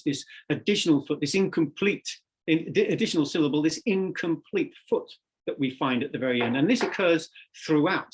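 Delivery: normal talking voice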